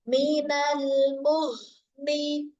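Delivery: Minal muhni very slow, air flowing through the h; slow recitation. Shown as a demonstration. Teacher models Minal muhni with breathy h. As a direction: let breath and voice flow together. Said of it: "Tuh ada aliran nafas sama suara".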